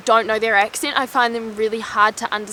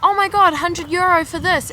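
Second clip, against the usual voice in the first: mock shock; 7 semitones higher than usual. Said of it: "you'd be like".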